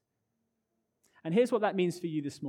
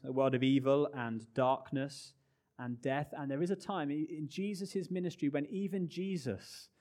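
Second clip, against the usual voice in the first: normal talking voice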